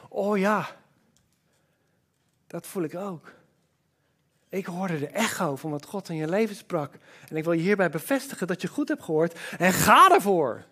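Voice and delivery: quiet, gentle voice of a fellow believer; soft affirming voice. Retold or imitated. Preacher voicing a friend who gently confirms.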